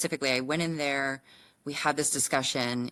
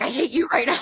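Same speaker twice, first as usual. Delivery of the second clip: louder, higher, laughing through it; laughing outburst; 9 semitones higher, 6 dB louder than usual. Retold or imitated. Wife answering her husband, laughing and exasperated. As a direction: blurt it out while laughing.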